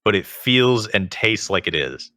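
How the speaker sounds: normal talking voice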